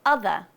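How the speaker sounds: non-rhotic ending; schwa ending. Shown as a demonstration. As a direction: say "other" as uh-thuh. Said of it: "Another one is".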